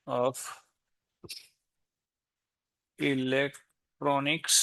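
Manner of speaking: each word drawn out while typing; slow dictation. Real, not acted. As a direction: spell it out word by word as you type. Typing description while dictating aloud. (Type करताना हळू, एकेक शब्द सांगत)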